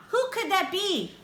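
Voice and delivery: ordinary delivery, nothing marked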